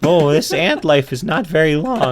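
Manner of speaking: a hint of a maturing little ant; teenage ant. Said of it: "my voice is getting deeper".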